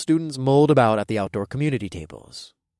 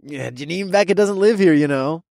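mocking frat bro; 5 semitones higher, 4 dB louder than usual. A narrator's reading. Toby mocking.

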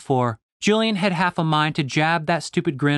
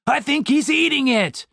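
much higher frantic yell; panicked cry; 10 semitones higher than usual. A narrator's reading. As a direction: shout in horrified alarm. Dave panics about beard.

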